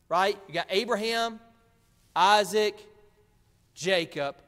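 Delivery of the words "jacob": usual speaking voice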